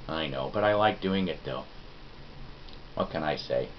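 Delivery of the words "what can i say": normal talking voice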